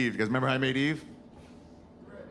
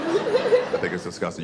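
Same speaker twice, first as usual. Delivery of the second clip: high, slow, disgusted squeal; a god recoiling in disgust; 5 dB louder, slower than usual. Acted. God voiced disgusted, acted.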